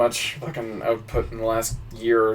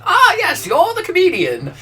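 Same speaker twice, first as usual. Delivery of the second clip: much higher, laughing regal voice; queen's voice; 5 dB louder than usual. Retold or imitated. The queen greeting the nervous comedian, much higher. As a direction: greet him as a lofty high queen. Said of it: "when she went".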